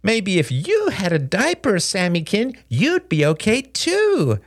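babying kid mockery; taunting. A narrator's reading. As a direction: taunt your little brother. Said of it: "his brother's taunting face".

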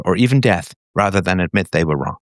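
normal talking voice

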